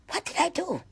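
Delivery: high, soft, anguished question; pained plea. Real, not acted. Pained memory of beating.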